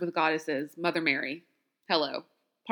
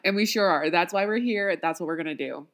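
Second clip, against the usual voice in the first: ordinary delivery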